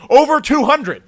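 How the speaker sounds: real exasperation; angry. Real, not acted. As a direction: hammer the number home in frustration. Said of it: "I'm sorry for how mad I'm getting".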